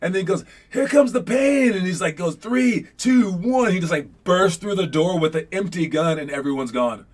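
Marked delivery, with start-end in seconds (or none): 0.7-1.7 high-pitched movie-hero warning; bluffing threat. Retold or imitated. Trapped hero warns men before bursting out, bluffing threat. 2.4-3.7 high, called-out countdown; bluffing countdown. Retold or imitated. Retold hero readies to burst through door, high countdown.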